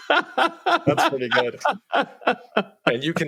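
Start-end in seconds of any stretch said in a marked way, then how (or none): 0.0-1.6 much higher, slow laughing reply; laughing hard at the co-host's joke. Real, not acted. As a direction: crack up while saying it. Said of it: "laughing at co-host's bit".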